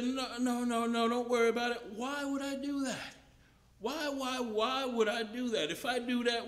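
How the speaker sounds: pleading scorpion. Acted. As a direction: talk the frog into trusting you. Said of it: "And the scorpion said".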